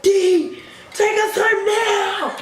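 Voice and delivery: high, drawn-out whiny shout; hammed-up wailed order; mock demanding yell. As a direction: imitate girls demanding a lift. Acted demand from pressing girls.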